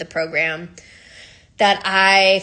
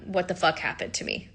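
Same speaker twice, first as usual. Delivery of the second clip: stunned quiet; 8 dB quieter than usual. Retold or imitated. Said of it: "that was what I said".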